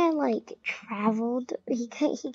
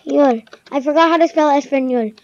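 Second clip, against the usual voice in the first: loud; 8 dB louder than usual. Real, not acted. Loud mock Spanish.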